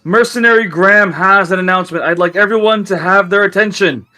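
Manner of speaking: loud, high herald calling the room; yelled announcement. Acted. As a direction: stand on the table and cry out for attention. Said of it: "he yells, he goes".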